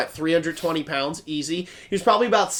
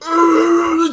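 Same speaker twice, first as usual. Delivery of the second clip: high roaring yell imitating a heckler; loud drawn-out mock roar; wild yell; 10 semitones higher, 5 dB louder than usual. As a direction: mimic a big guy yelling wordlessly. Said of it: "this one guy who's been yelling".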